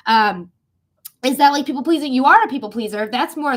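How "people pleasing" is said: usual speaking voice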